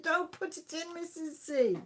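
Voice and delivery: playfully begging her not to; retold warning. Host retelling how she warned viewer, mock pleading. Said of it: "I said".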